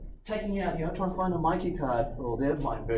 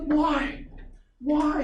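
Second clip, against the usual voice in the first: exasperated why; 13 semitones higher, 3 dB louder than usual. Retold or imitated. Exasperated inner question.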